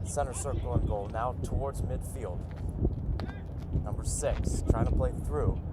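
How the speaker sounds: live match call, steady and quiet; play-by-play. Acted.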